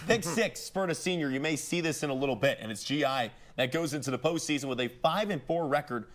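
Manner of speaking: usual speaking voice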